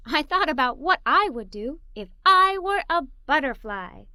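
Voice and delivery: ordinary delivery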